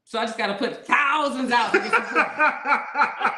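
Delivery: half-laughing mock outrage. Real, not acted. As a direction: protest it loudly through laughter. Outraged at paying for foreplay.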